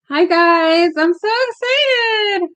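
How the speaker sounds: high, drawn-out excited greeting. Real, not acted. Streamer greeting the live chat, very excited.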